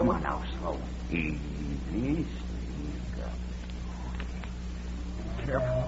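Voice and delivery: hushed. Acted. To the men sneaking up on the bull, hushed and cautious. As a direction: keep it down so the bull won't hear.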